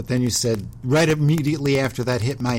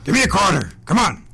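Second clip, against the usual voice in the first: raised, louder pushy dog character; demanding dog; 6 semitones higher, 5 dB louder than usual. Pretend. Host plays a dog begging change, demanding. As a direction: demand it loudly, higher than usual. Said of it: "I'm gonna be the dog".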